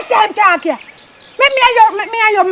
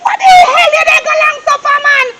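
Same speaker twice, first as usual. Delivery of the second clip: angry shout; 4 semitones higher than usual. Acted. Man yelling at his lover for coming to his yard, angry shout. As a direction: yell at her like a furious lover. Angry quarrel with the other woman.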